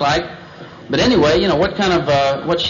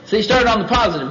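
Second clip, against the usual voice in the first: ordinary talk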